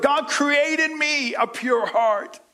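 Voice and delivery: high, slow, aching plea; preacher voicing David crying out; crying out to God. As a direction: beg God from the heart. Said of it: "He cries out".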